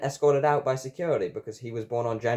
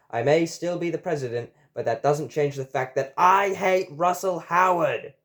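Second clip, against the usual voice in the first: building indignation; 5 semitones higher, 4 dB louder than usual. A narrator's reading. Elected Nixon rails at Russell Howard, increasingly indignant. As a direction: build to a hammed-up outburst of hate. Nixon declares his hatred.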